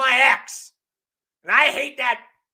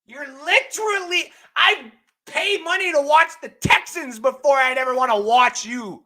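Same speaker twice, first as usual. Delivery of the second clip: normal talking voice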